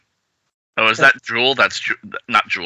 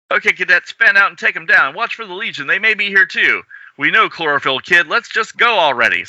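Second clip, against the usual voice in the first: leader giving orders; 9 semitones higher than usual. Acted. Cadet leader gives orders.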